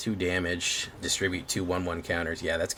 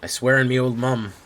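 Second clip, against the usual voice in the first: mock oath; 6 semitones higher, 8 dB louder than usual. Repeated oath, exaggerated take.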